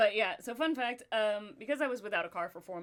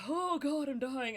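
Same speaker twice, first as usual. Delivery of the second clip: mild, higher-pitched groan of suffering; mock agony; 6 semitones higher, slower than usual. On stretching sore shoulders, mock agony. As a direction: play up the pain a little. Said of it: "but it's also like".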